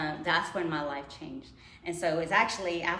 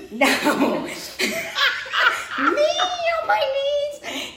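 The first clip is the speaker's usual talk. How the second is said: high, loud laughing no; incredulous laughing protest; laughing refusal; 12 dB louder, much slower than usual. Retold or imitated. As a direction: laugh off the very idea, much higher. Mocking her own refusal.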